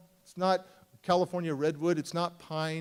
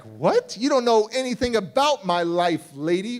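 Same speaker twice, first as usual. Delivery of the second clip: loud, raised-pitch outburst; heated, incredulous comeback; indignant retort; 4 semitones higher, 8 dB louder than usual. Retold or imitated. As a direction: fire back, affronted. Angry retort to the counselor.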